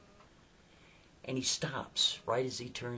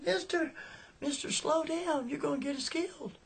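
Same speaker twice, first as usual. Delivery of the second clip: very high, panicked squeal of a voice; Becky. Retold or imitated. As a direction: imitate a terrified girl begging the driver. Girl pleads with speeding driver.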